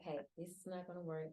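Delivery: very soft, low, calm objection; regular conversational voice. Acted. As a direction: say it quietly, without any heat. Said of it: "my regular conversation is just like".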